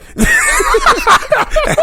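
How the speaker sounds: real burst of very high, loud laughter. Real, not acted. Wrestler cracking up at the fake-injury merch scam, loud high laugh. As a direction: crack up loudly, pitch shooting right up. Laughing at his own gimmick story.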